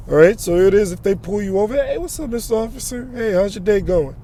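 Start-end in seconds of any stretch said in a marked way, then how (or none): 1.7-4.1 higher, soft, overly pleasant greeting; friendly driver. Retold or imitated. Preacher showing how to greet the officer, sugary and soft. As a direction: sweeten up to the officer.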